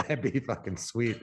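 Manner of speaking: ordinary delivery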